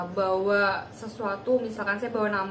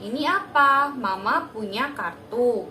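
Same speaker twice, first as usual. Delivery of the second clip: put-on a therapist naming a card to a toddler; clear teaching voice; 2 semitones higher, 5 dB louder, much slower than usual. Shown as a demonstration. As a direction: speak to a small child, slow and emphatic. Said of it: "perlahan satu-satu dulu".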